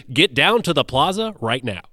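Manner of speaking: announcer-style summons, pushed out with force; Ken Double. Retold or imitated. Friend urging him down to the plaza, announcer voice. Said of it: "he has this great announcer voice".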